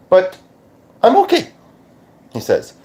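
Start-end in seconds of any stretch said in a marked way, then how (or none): 0.1-1.5 put-on high borrowed accent with rising end; pitched-up British-Australian-leaning accent. He reassures her after his passing, put-on accent. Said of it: "doing the accent".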